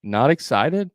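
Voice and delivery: "excited" lifted at the end like a question; rising inflection. Shown as a demonstration. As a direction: mirror "Not excited" back with a questioning rise. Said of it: "You have to add an inflection".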